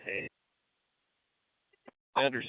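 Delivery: ordinary delivery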